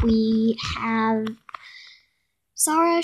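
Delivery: usual speaking voice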